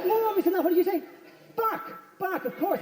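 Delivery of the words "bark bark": high, softer mock bark; barking like a dog. Comic barking at the burglar, mock dog. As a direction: yap it like a small dog. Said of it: "like a dog".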